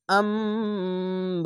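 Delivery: আম্বাইল hummed through the nose, very slowly; slow Quranic recitation. Shown as a demonstration. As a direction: recite it with a nasal hum. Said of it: "এই মিমকে পড়বেন". (কুরআন তেলাওয়াতের সুরে ধীরে পড়া)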